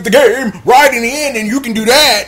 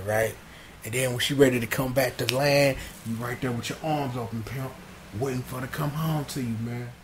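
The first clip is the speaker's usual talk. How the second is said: hushed, lower voice of a smooth mentor; smooth low pimp talk; 8 semitones lower, 7 dB quieter than usual. Retold or imitated. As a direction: lower and much softer, laid-back. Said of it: "he backing on like".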